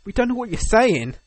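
high, loud protest; mock exasperated complaint. Villagers to the one Welsh speaker, mock exasperation.

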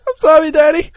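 put-on high, loud little-kid squeak; scolded child. Co-host playing child apologising to Daddy, high squeaky voice. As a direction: play along as a squeaky child.

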